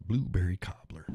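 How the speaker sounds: whispered, breathy repeat of the coffee name; just breathe the name out. Shown as a demonstration. Host whispering the coffee name back to listeners.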